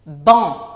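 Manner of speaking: ban said loudly and crisply as a test word; clear dictation word. Shown as a demonstration. Teacher dictating a test word, loud and clear. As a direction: give ban loud and clear for the class to write. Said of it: "Je prononce".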